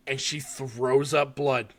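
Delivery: ordinary delivery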